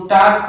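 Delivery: "टाक" with no L sound; silent L. Shown as a demonstration. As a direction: say "टाक", long vowel straight into the K. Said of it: "यल हमेशा silent रहता है".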